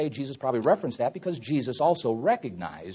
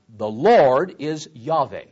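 ordinary talk, nothing marked

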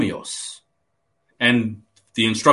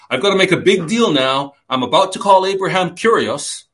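higher, louder, making a big deal; mock grand announcement; 7 semitones higher, 6 dB louder than usual. Preacher imitates what Sarah was not saying, mock-momentous. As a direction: announce it with exaggerated importance.

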